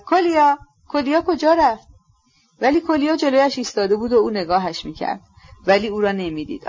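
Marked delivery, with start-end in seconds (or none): 0.0-1.9 high, raised-voice call. A narrator's reading. Ippolit shouting for Kolya to show the way, high call. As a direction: call out urgently for someone missing. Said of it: "و فریاد زد".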